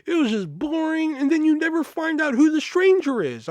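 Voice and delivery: high, whiny complaining customer; mock customer voice. Customer griping to the clerk, high and whiny.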